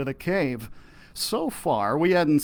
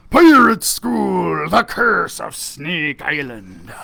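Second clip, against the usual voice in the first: slow, breathy title announcement; dramatic title; 8 dB louder, slower than usual. Acted. Captain announcing today's story title, dramatic. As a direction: announce it like a mystery.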